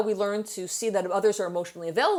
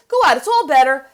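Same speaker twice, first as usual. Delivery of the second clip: brisk dismissive mother; 5 semitones higher, 10 dB louder than usual. Retold or imitated. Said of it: "she's like".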